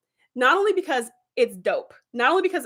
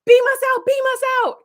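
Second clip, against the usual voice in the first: higher, louder cry to beam out; urgent plea; 13 semitones higher, 6 dB louder than usual. Retold or imitated. Host voicing Shelby in the crisis, an urgent higher louder cry. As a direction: yell it like the ship's going down. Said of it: "And Shelby's like".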